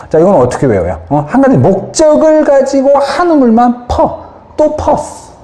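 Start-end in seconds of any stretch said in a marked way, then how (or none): 1.3-5.3 much higher, lively storytelling voice; animated. Acted. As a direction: sell the memory trick with energy. Mnemonic sentence performed.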